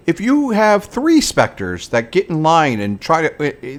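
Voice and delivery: ordinary talk, nothing marked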